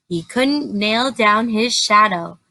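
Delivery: ordinary delivery, nothing marked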